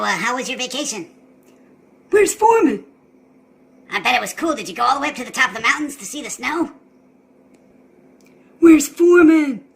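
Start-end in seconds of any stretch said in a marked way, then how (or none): 2.1-2.8 much higher and louder demand; insistent question. Acted. Owner asks for his dog. 8.6-9.6 much louder, slow and stretched; slow loud demand. Acted. Asks again, more urgent.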